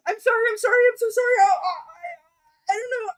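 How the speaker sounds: loud, high, breaking flustered apology; tearful embarrassed panic; panicked apology. Acted. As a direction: sob out a frantic sorry. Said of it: "and goes".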